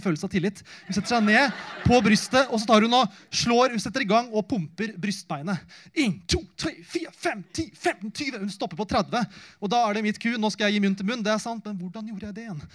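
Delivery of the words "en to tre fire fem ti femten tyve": higher, rhythmic counting aloud; counting compressions. Retold or imitated. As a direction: count out each push.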